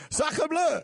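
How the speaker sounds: high shouted bad French accent; mock French outcry in a French accent; terrible French accent. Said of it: "there's a terrible French accent".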